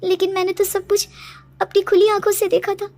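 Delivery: high, loud, agitated voice; distressed protest. Acted. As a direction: protest in fearful disbelief. Daughter protests it was no dream. (घबराया हुआ, ऊँचा विरोध)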